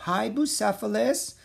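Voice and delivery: high, friendly sing-out greeting; warm, friendly. A narrator's reading. Annie greets a wild-eyed stallion, warm and friendly. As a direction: greet the horse sweetly in a high voice. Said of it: "said in a warm, friendly voice".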